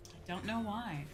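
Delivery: usual speaking voice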